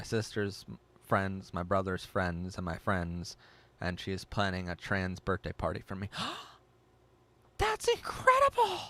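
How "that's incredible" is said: very high, loud thrilled squeal; excited squeal. Real, not acted. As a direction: burst out overjoyed for them.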